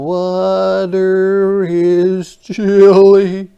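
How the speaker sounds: high, loud, slow fish voice; a shivery little fish; storybook fish in cold water. Acted.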